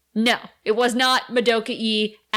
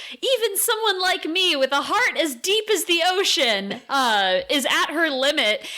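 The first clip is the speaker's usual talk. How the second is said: mock heroic yell; 7 semitones higher than usual.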